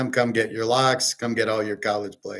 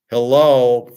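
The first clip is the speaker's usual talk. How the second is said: sarcastic loud hello; 9 dB louder than usual. Real, not acted. Sarcastic jab at price fixers.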